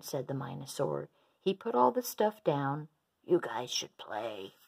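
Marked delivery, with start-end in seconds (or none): 3.2-4.6 low, slow, subdued offer; resigned. A narrator's reading. Minosaur putting all the stuff down, resigned. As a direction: let it drop, giving up.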